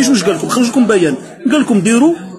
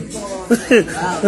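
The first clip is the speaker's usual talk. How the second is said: mocking laugh; 2 semitones lower, 6 dB quieter than usual. Real, not acted. Laughing at the army court.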